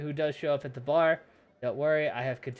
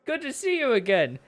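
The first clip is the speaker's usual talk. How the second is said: suave, theatrical nobleman voice; courteous nobleman; 3 semitones higher, 9 dB louder than usual. Acted. Nobleman reassures the tavern he is no vampire, grandly courteous. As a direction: play a courtly gentleman greeting acquaintances.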